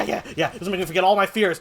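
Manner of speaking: ordinary talk, nothing marked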